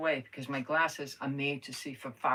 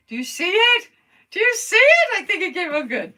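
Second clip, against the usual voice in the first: high, loud excited squeal; 12 dB louder than usual. Real, not acted. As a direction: squeal it with delight. Thrilled the glue is drying.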